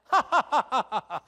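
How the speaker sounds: a short put-on laugh for Adam; acted old man's chuckle. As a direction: laugh like an old man at his right hand. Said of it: "he laugh".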